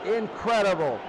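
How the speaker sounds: normal talking voice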